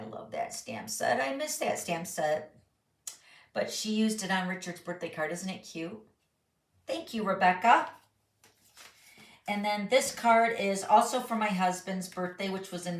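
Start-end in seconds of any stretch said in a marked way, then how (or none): none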